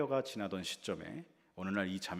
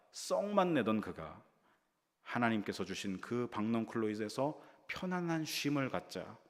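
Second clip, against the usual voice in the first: ordinary talk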